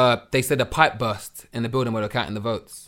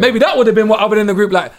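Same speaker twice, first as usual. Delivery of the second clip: ordinary delivery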